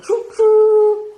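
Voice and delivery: high, loud sing-song choo choo; high, loud choo choo like a toy train. Pretend. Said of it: "Can you say".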